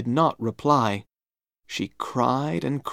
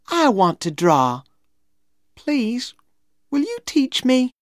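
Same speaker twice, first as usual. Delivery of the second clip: put-on high-pitched girlish plea; pleading little girl; 13 semitones higher, 3 dB louder than usual. A narrator's reading. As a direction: beg sweetly to be taught, voice high. Becky pleads to be taught.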